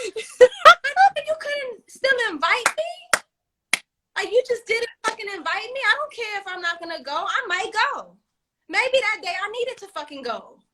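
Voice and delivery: high-pitched, comically offended protest; hammed-up hurt at being left out; mock indignation. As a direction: protest like you have been snubbed.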